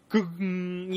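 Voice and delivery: voiced gong strike with a humming ring; imitated gong. Podcaster mimicking a movie's sound cue, imitated gong. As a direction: imitate a gong with a resonant tail. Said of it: "you would hear the, the gong".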